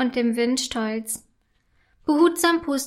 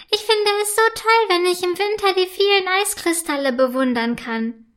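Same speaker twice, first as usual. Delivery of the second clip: much higher, louder gushing fairy; high ice elf voice; 6 semitones higher, 3 dB louder than usual. A narrator's reading. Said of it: "spricht die hohe Stimme weiter".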